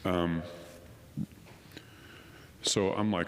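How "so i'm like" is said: ordinary talk, nothing marked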